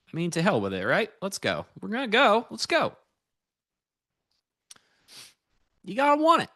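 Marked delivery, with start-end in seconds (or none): 1.8-2.5 pumped, rising, loud cheer; hyped up. Real, not acted. Hyping himself up on trade. 2.5-2.9 high, pumped rallying cry; excited. Real, not acted. Hyping up the trade. 5.8-6.5 pumped, rising, loud cheer; hyped up. Real, not acted. Hyping himself up on trade.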